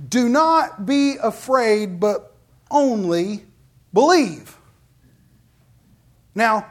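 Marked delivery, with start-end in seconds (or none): 0.1-4.6 slow, drawn out, each word weighted; Jesus comforting the grieving ruler, slow and weighty; slow emphatic reassurance. Acted. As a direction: press every word home to calm his fear.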